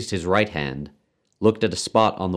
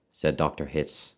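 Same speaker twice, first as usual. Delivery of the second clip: usual speaking voice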